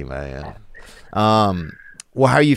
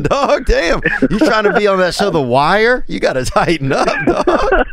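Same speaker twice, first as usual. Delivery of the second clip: incredulous teasing; 8 semitones higher than usual. Real, not acted. Host teasing caller, high pitched.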